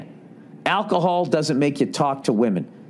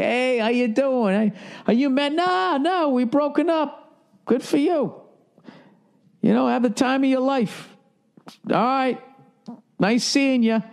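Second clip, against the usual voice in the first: much higher, bright put-on cheerfulness to an ex; fake friendly cheer; 9 semitones higher than usual. Greeting the ex-girlfriend with a forced big smile. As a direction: smile through it like nothing hurts. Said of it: "just big smile on your face".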